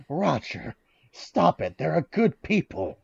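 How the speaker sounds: lightly voiced old Bert Cooper; gentle ghostly old man rebuke. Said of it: "the ghost of Bert Cooper going like".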